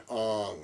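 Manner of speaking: "Aang." stretched and soft like a yawn; mock-bored yawn on "Aang."; yawning name. Shown as a demonstration. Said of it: "sounds like everybody's yawning".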